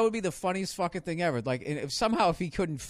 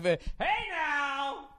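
high sportscaster call; mock announcer shouting a warning; loud announcer; much slower than usual. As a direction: call it out across the room like a play-by-play man. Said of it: "The fucking announcer".

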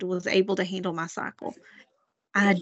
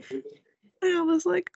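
normal talking voice

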